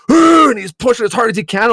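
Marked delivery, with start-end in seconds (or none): 0.1-0.5 high, loud effortful grunt; straining grunt. Retold or imitated. Brother pushing the knife in, loud straining grunt. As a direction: grunt like pushing with all your weight. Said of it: "he's like".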